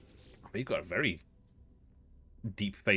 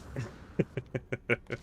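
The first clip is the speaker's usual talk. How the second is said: breathy burst of real giggles; helpless run of quick chuckles; laughing; 4 semitones higher than usual. Real, not acted. Amused player at strange face, laughing.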